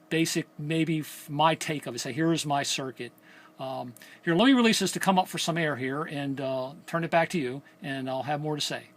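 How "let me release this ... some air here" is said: usual speaking voice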